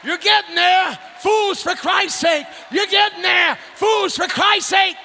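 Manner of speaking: shouted call to the crowd; preacher teasing the congregation; preaching. Acted. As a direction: egg them on, preacher style.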